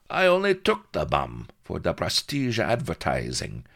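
high, croaky foreign dealer in broken English; scornful slaver. Acted.

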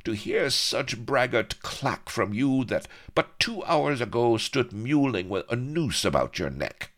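high, scornful tirade; impatient scorn. A narrator's reading. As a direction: scold the braggart. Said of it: "out of all patience".

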